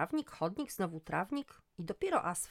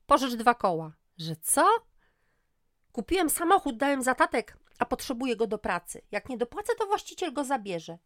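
much higher, louder pushy ex; 7 semitones higher, 11 dB louder than usual. Acted. Ex-husband demands a loan, loud and pushy. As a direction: demand the money, brash.

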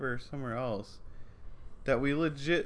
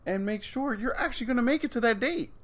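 mock lecture; 11 semitones higher than usual. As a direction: scold the texting driver.

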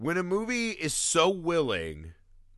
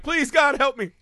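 high, loud mock begging; frantic plea; 10 semitones higher, 5 dB louder than usual. David running to his friend, begging loudly. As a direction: beg it desperately, hammed up.

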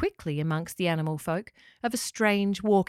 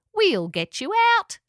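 loud, high character voice; pelican rescuer; 11 semitones higher, 6 dB louder than usual. A narrator's reading. Louise promises to free the echidna, loud pelican voice. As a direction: promise help at high pitch.

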